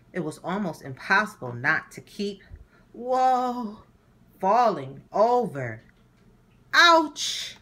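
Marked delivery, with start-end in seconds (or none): 2.9-7.6 high, louder startled whoa then ouch; losing balance, yelp; wobbling whoa. Acted. Herb falls over in new shoes, loud high ouch.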